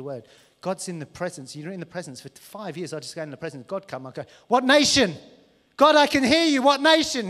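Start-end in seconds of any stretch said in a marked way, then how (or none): 4.5-7.3 high, loud hammed-up cry; hammed-up eager shouting at God; mock eager shout. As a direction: yell it like an overexcited zealot. Mock over-eager prophet shouting.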